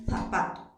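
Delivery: ZAPATO starting with "th", "thapato"; tongue between the teeth on the Z of ZAPATO; Castilian Z. Shown as a demonstration. Teacher imitating a Spaniard's pronunciation for students, Castilian th. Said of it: "perdón, un español pronuncia".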